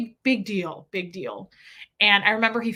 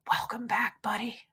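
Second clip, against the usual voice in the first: hushed; 7 dB quieter than usual. Retold or imitated. Mimics official greeting her child.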